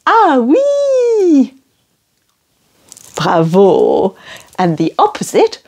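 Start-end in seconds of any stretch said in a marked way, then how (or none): 0.0-1.5 "Ah, oui" with a gasp, high and keen; eager French yes. Shown as a demonstration. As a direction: gasp then a delighted ah oui. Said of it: "we tend to say".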